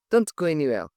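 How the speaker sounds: usual speaking voice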